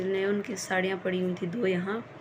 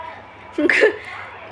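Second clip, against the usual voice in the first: helpless breathy laughter bursting out; high giggling fit; 8 semitones higher, 4 dB louder than usual. Real, not acted. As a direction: laugh out loud at the joke. Mother laughing at son's mishearing.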